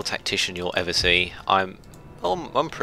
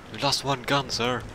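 put-on a half-hearted Dutch accent, higher; failed Dutch accent; 5 semitones higher than usual. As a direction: try a Dutch sailor reporting to his captain, and miss. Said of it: "I can't do a Dutch accent".

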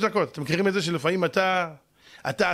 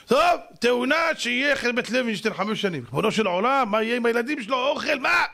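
mounting indignant appeal; pleading protest; 7 semitones higher than usual. Acted. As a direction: pitch climbing as the protest builds. Heavenly advocate protests the sentence.

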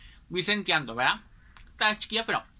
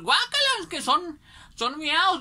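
much higher, grossed-out squeal; disgusted kids; 10 semitones higher than usual. Retold or imitated. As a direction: recoil in disgust as a kid. (niños asqueados, voz aguda)

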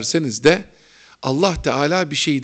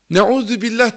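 yelled higher and louder, teasing; mocking shout; 6 semitones higher, 4 dB louder than usual. Street vendor mocking the hodja, a loud jeering shout. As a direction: call out the nickname across the market. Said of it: "bana çarşıda bağırdı".

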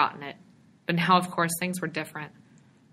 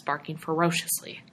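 ordinary delivery, nothing marked

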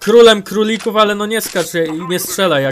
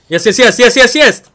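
rapid-fire repeats, climbing louder; urgent sighting; 3 semitones higher, 5 dB louder than usual. Real, not acted. Gamer alerting team to spotted enemy, urgent. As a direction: build quickly as the enemy appears.

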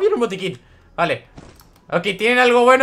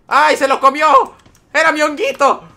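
dismayed cry; 6 semitones higher, 5 dB louder than usual. Real, not acted. Gamer whose teammate grabbed his mushroom, louder dismayed wail. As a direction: protest like your snack was stolen.

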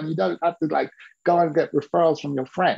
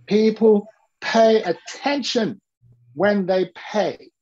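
slow, weighty teaching line, each word landed; a teacher drilling a lesson, slow and firm; slow emphatic rule; 4 semitones higher, slower than usual. Shown as a demonstration. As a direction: state the rule slowly so it sticks.

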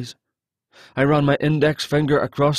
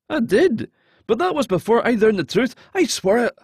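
higher voice, earnest and urgent; pleading captive; 8 semitones higher than usual. Acted. Captive convincing the hero he has changed, pleading. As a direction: swear it, desperate to be believed.

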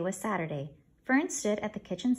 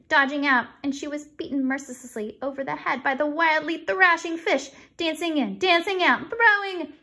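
excited storytelling; 7 semitones higher, 7 dB louder than usual. Acted. Fern's tale builds breathlessly.

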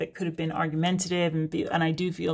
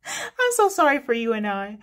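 joking; 4 semitones higher than usual. Pretend. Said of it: "joking with the wrong grammar".

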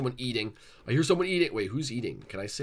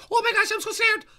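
very high, loud falsetto squeal; hammed-up fright; mock terrified shriek; 11 dB louder than usual. Sonic watching Tails get chased, shrieking in fake terror.

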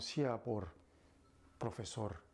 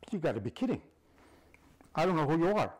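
loud disbelief; 5 semitones higher, 9 dB louder, much faster than usual. Acted. Einstein protests to stranger.

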